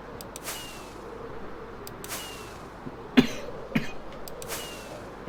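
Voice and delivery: long dry coughing fit. Real, not acted. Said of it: "Sorry about all the coughing".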